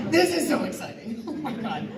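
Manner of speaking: high, loud, fast and laughing with excitement; thrilled gush. Retold or imitated. Actor recalling seeing the Rockstar logo, a laughing, excited gush. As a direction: gush like a fan who just saw the logo.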